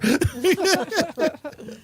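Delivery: high bright laugh. Real, not acted. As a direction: burst out laughing. Laughing at the story.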